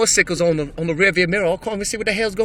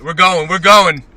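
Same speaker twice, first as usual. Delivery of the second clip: loud, keyed-up chant; excited shout; 12 dB louder, slower than usual. Real, not acted. Driver cheering himself on through the snow, loud and excited. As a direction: psych yourself up to go.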